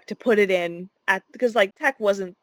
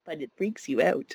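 ordinary delivery

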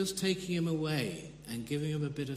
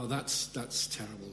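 mock passing pity; 5 semitones lower, 4 dB quieter than usual. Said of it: "more than a passing pity".

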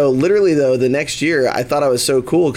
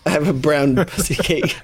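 laughing; 4 semitones higher than usual. Real, not acted. Friend joking about the presentation.

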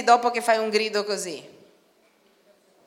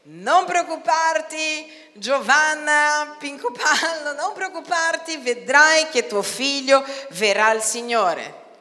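mock God's answer; 5 semitones higher, 5 dB louder, slower than usual. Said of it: "Dio che ti dice".

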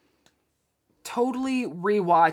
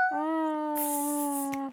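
ordinary talk, nothing marked

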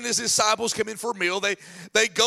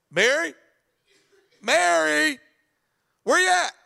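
higher, louder calls building up; calling out; 7 semitones higher, 3 dB louder than usual. Retold or imitated. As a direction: call through the house for Mary. Martha calling for her sister.

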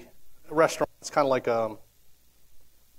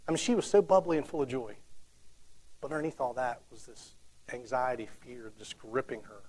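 ordinary talk

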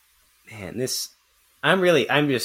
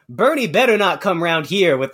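higher put-on voice of a woman tweeting slang; mocking a tweeter; 7 semitones higher, 4 dB louder than usual. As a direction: imitate her affected slang, pitched up. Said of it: "she was like".

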